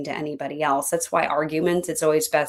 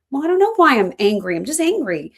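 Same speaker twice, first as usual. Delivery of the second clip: higher, louder, mock-angry protest; exasperated retort; 7 semitones higher, 6 dB louder than usual. Coach playing someone asked why they are angry, exasperated. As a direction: act out being fed up with the question. Acts answer to why-question.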